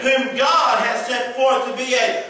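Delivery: loud, high shouted preaching. Real, not acted. Pastor to congregation on sin, shouted preaching.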